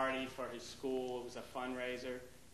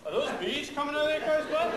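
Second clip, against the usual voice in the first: much higher, louder appalled question; shocked dad; 12 semitones higher, 10 dB louder than usual. Retold or imitated. Dad at the nude beach, higher and louder in shock. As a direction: blurt it out in disbelief.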